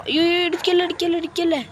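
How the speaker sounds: high, frantic please-don't-crash cries; begging the car not to hit, voice jumping up; panicked pleading. Real, not acted. Gamer watching his car about to crash, high panicked pleading.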